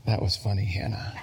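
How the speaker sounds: put-on low, hushed child's aside; conniving little boy. Two-year-old boy to his older sister after a scolding, slyly hushed. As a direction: imitate a naughty toddler confiding, low and quiet. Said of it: "he says to his sister".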